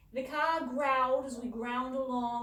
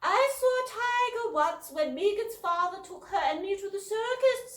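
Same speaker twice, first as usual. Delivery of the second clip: high, loud put-on British accent; 11 semitones higher, 9 dB louder than usual. A narrator's reading. Elder sister bragging about the circus in a put-on British accent. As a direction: show off in a posh British accent. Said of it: "in a brutish accent".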